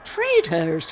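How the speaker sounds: loud angry growl; forceful, outraged growl. A narrator's reading. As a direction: growl it as an accusation. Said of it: "Father growled".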